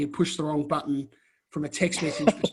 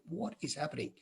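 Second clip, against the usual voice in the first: retold stunned question, much softer; dazed mutter; 15 dB quieter than usual. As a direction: say it almost under the breath, stunned. Baffled after being brushed off.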